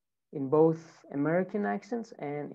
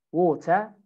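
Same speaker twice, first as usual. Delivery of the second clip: British "water", "waw-tuh" with no final R; British accent. Shown as a demonstration. Teacher drills British "water", no R at the end. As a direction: say "water" the British way, crisp T, R dropped. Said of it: "in British".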